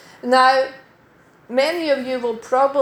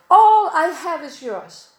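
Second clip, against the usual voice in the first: slow, higher and louder, lingering on "All"; tender promise; 5 semitones higher, 5 dB louder than usual. Retold or imitated. Preacher voicing God's promise to his children, slow and tender. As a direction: hand them the promise slowly and warmly.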